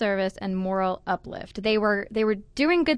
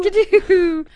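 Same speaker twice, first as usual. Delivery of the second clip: high, loud flapper exclamation; carefree flapper; 10 semitones higher, 9 dB louder than usual. Retold or imitated. Podcast host playing the unbothered flapper, loud and high-pitched. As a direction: sing out the slang high and loud. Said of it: "the flapper was like".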